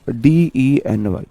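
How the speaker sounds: slow letter-by-letter spelling of डीईएनवाई. Shown as a demonstration. Teacher spelling the word for students, slow and deliberate. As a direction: spell D-E-N-Y slowly and softly. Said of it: "यहाँ देखो एक word deny".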